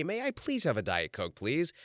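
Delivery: softened, well-mannered asking; polite request. Shown as a demonstration. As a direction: ask nicely, lifting the final please. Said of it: "The way I was raised, you're supposed to say".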